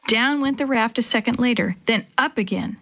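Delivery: plain reading voice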